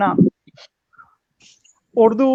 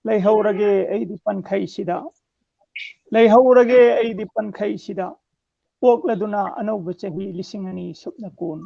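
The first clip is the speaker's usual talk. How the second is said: measured declamation in separate phrases; strong line openings, falling cadence; poem recitation; 4 dB louder than usual. Acted. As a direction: recite verse line by line with pauses. Recites own poem on request.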